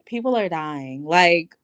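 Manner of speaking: lightly imitated low, deadpan celebrity voice; Kourtney Kardashian. Reviewer dismissing a petty fight, lightly imitated Kourtney Kardashian voice. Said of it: "in my Kourtney Kardashian voice".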